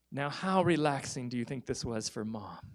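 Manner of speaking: ordinary talk, nothing marked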